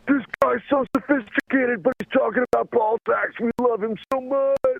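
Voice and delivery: high, slow mock British accent praising him; mock posh British. Audience gushing over the fake British comic, in a high mock British accent. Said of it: "radio audience was like".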